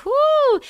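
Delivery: loud, high "whoo"; high whoop. Real, not acted. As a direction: let out a big whoo. Whoops after encouraging a viewer.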